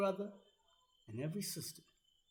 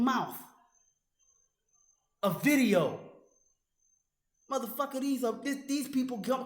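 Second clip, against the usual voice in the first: loud, higher, fast furious tirade; furious yelling at his critic; angry shouting; 5 semitones higher, 6 dB louder than usual. Real, not acted. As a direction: hammer him with it. Rant resumes at full force.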